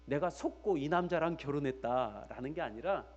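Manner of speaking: ordinary delivery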